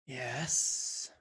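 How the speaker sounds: rasping, wheezing creature. Acted. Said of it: "rasping, wheezing voice".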